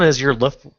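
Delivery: roof slipped into "loof-", L for R, cut short; tongue slip. Real, not acted. Tutor tripping over the ad title, tongue slip on "loof-". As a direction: stumble on the word, saying "loof-" with an L. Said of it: "I can't even say it".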